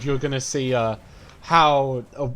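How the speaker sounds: normal talking voice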